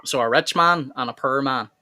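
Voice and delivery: "per" said like purr in "per man"; Irish say "rich man and a per man"; Irish "poor". Shown as a demonstration. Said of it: "apparently we say "per" wrong".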